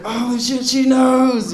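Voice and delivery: high, loud panicked realization; retold shock. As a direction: exclaim it as if suddenly found out. Retold shock at customer knowing.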